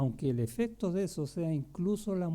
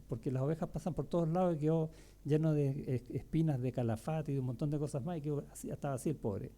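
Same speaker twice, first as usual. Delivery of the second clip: ordinary talk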